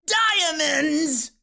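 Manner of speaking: high-pitched, drawn-out witchy cry; witch's shriek. A narrator's reading. Chaos Witch cries from inside the cup, shrieking. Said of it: "shrieked the voice of the Chaos Witch".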